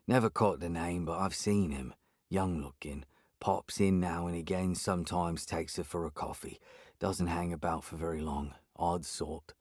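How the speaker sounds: put-on soft, low old local voice; lightly voiced old man, mumbling softly; Benny's voice. A narrator's reading. Benny describing the stranger.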